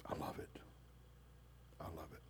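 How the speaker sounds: soft delighted whisper, barely voiced; hushed whisper. God savoring his people's worship, whispering softly in delight. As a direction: breathe it like a secret pleasure.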